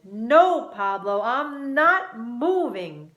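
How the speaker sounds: loud, slow refusal; loud defiance. A narrator's reading. Luke loudly refuses to move over. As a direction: dig in your heels. Car-seat quarrel.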